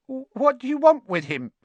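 high, frightened, trembling question; scared boy confronting Harry; quavering voice. A narrator's reading. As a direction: let the fear shake through it. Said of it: "in a quavering voice".

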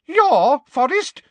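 high, loud, slow outrage; indignant fright. A narrator's reading. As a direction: echo it in affronted disbelief. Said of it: "shaking now not only with fright".

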